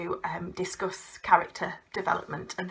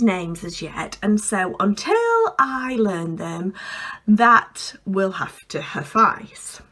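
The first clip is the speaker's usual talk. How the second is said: Nicola; 4 dB louder than usual. Acted. New head of a health club welcoming guests, officious and deliberate. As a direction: project like an officious hostess, stretching out the key words. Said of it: "being Nicola".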